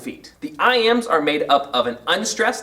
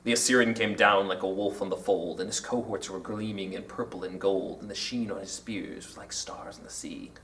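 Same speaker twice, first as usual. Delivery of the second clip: very low, soft rhythmic verse; poem recitation; 10 semitones lower, 10 dB quieter than usual. Shown as a demonstration. Teacher reciting Byron's galloping verse, low hushed recitation. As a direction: recite in a deep hush, fading softer.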